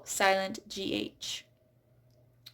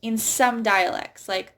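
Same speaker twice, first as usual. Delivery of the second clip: ordinary delivery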